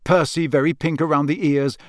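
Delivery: plain reading voice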